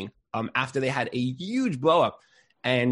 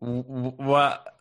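speechless stammer; slower than usual. Retold or imitated. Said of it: "I was like".

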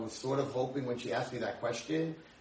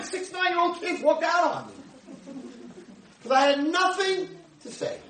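much higher and louder, exasperated; worked-up outburst; 7 dB louder than usual. Real, not acted. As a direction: let it burst out, indignant and high. Agitated outburst about kids walking out.

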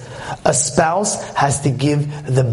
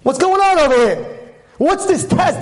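put-on a higher, pushy young girl's voice; sassy young girl; 10 semitones higher than usual. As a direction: snap back at the man as the girl. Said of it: "this young girl pulling all the box".

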